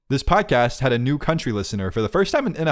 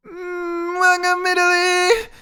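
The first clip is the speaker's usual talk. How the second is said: hammed-up Italian accent, very high and drawn out; mock traditional Italian accent; much slower than usual. Shown as a demonstration. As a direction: do a badly faked, high-pitched Italian accent. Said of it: "that was traditional Italian".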